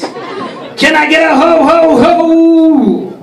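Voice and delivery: high, drawn-out ho-ho-ho call; Santa calling out. Acted. Santa rousing his audience for a ho-ho-ho, high drawn-out call. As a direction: rouse the crowd with long, high hos. Santa calls to the crowd for hos.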